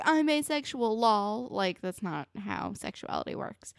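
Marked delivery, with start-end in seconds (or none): 0.0-1.5 high, airy mock-casual voice; flippant mocking. Host mocking someone faking an identity, high and flippant. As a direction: toss it off like a joke.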